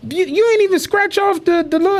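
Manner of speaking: high, mock-shocked question; high incredulous call-out to a friend. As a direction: act stunned that he skipped the game. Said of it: "I'm like".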